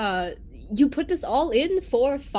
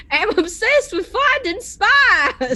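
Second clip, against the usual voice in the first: mocking the drawl; 6 semitones higher, 5 dB louder than usual. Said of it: "everyone doing my accent back at me".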